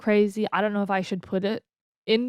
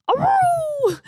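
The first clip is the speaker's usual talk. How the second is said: high yelping dog cry; 5 dB louder than usual. Retold or imitated. Vlogger mimics her dog's yelp when hit, high yelp. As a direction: imitate the dog's sharp yelp. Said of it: "I just hear him go".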